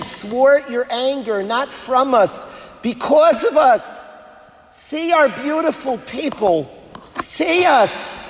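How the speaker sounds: fervent prayer voiced for David HaMelech, building to a shout; impassioned plea. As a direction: beg with growing fervour. David HaMelech praying to Hashem.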